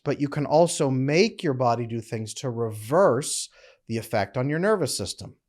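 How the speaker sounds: ordinary talk, nothing marked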